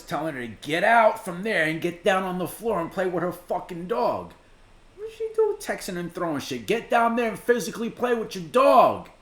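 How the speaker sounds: loud, high-pitched telling-off; exasperated scolding. Real, not acted. Reactor telling off a neglectful dog owner, exasperated scolding.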